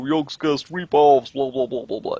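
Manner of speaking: dragged-out jeer trailing into blahs; mocking. Imitating commenters accusing him of copying, mocking. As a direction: mimic dismissive critics, dropping into toneless babble.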